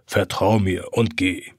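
calm him into going; old clock coaxing the boy; gentle reassurance. A narrator's reading.

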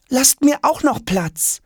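put-on high, slow boyish request; pleading. A narrator's reading. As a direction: beg for room in a high voice. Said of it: "bittet Jonas". (hoch und bittend)

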